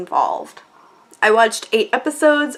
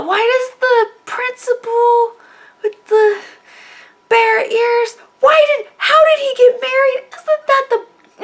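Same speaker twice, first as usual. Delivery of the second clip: high, louder confused questions; baffled; 11 semitones higher, 4 dB louder than usual. Retold or imitated. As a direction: ask in total bewilderment. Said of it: "You're gonna go".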